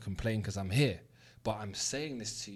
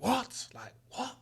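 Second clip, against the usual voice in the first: mum's pitch jumps high, then the second what drops to a breathy whisper; shocked mum; 13 semitones higher, much slower than usual. Retold or imitated. Mum hearing her son refused Vogue, stunned gasp of disbelief. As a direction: play the mum stunned the boy turned Vogue down. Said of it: "she went mad".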